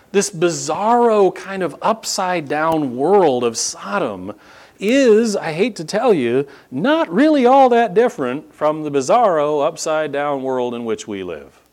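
ordinary delivery

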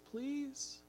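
a tender plea that breaks on the word; voice cracking. Retold or imitated. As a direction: let the voice crack as you beg softly. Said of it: "gets this little crack in his voice".

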